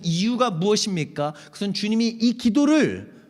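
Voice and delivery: loud, high, driving sermon voice; full-force preaching. Acted. Pastor urging the church about the Psalms, loud and high. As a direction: press the point home to the congregation.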